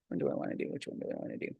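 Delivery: under-the-breath musing, barely voiced; whispered to herself. Real, not acted. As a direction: whisper the question to yourself while deciding. Musing to herself while choosing.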